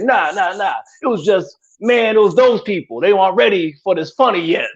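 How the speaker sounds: high, breezy know-it-all; cocky comedian voice. Retold or imitated. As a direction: brush it off with swagger. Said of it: "to be like".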